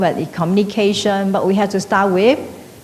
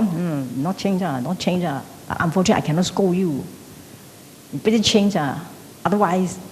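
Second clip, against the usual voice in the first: muttered grudge; 2 semitones lower, 7 dB quieter than usual. Retold or imitated. Silently cursing someone with grudges.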